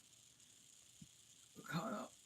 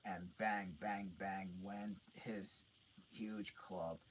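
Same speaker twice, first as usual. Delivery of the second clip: usual speaking voice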